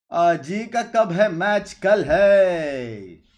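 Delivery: loud, slow, drawn-out announcement; stretched-out high-energy shout; loud call-out. Real, not acted. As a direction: boom it out, stretching the last words long. Hyped call-out of match question and answer.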